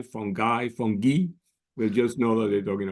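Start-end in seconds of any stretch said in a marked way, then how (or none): 0.0-0.7 "fungi" said as FUN-guy; hard G pronunciation. Shown as a demonstration. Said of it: "if they say fungi". 0.7-1.3 "fungi" said as FUN-ghee; ee-ending pronunciation. Shown as a demonstration. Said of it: "if they say fungi".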